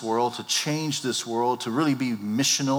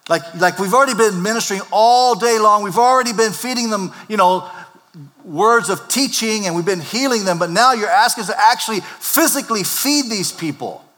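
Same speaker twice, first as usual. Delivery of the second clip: exasperated complaint; 7 semitones higher, 7 dB louder than usual. Acted. Voicing exasperated disciples.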